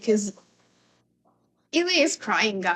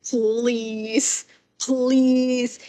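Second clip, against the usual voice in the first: loud, pleading please; teasing a character, louder each please; mock pleading; 2 semitones higher, 7 dB louder than usual. Real, not acted. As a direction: beg in playful exasperation. Begging the character on screen.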